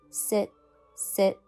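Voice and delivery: ordinary delivery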